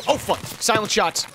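sudden high alarmed yelp; jolted, caught off guard; startled shout. Real, not acted. Ambushed by silent shots.

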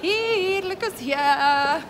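slow, loud happy welcome; excited greeting. Real, not acted. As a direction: greet him with delight. Greeting the husband on camera.

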